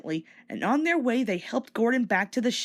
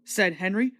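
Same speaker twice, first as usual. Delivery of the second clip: ordinary delivery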